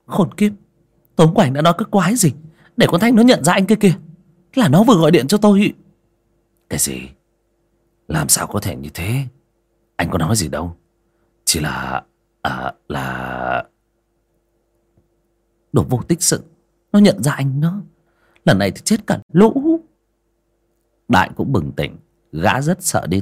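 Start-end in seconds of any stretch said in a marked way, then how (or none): 0.1-5.7 high, fast, angry yelling; furious shout. Acted. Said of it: "quắc mắt". 6.7-13.6 low flustered man. Acted. Đại hoảng sợ chống chế. 15.7-19.8 high, contemptuous scolding; angry scolding. Acted. Thủy mắng Đại.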